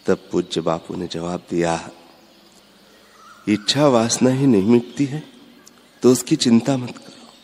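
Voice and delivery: normal talking voice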